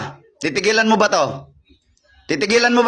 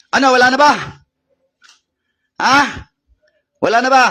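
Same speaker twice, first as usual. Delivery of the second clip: louder, higher, demanding questions; forceful challenge; 5 semitones higher, 4 dB louder, faster than usual. Real, not acted. Exorcist taunting a spirit during a healing, forceful challenge. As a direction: challenge them loudly and sharply.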